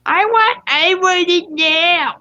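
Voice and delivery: high, drawn-out bratty whine; little brat. Acted. Entitled kid demands the board, high bratty whine. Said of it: "like a little brat".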